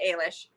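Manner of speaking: Eilish said as AY-lish; AY-lish pronunciation. Shown as a demonstration. Co-host stating how she pronounces Eilish, AY-lish. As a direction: say Eilish with a long "ay" first. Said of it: "I pronounce it".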